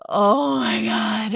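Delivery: slow, despairing oh my god; a hint of tearful wail; mock bawling. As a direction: drag it out like you are sobbing. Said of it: "like, bawling".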